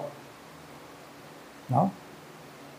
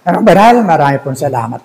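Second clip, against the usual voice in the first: solemn prayer; 3 semitones lower than usual. Acted. Preacher asking the congregation to choose, then praying, solemn.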